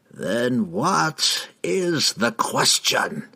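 slow, cold ghostly voice; cold hissing layered whisper. A narrator's reading. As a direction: speak like a proud vengeful specter. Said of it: "the spectre pressed".

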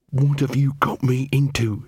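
lightly growled question; growling. Acted. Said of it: "she growled".

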